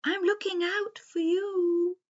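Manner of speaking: high, hooting sing-song owl. A narrator's reading. Wise owl warns the forest creatures, high hooting voice. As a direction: say it as a long high hoot. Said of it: "He blinked his big round eyes and said".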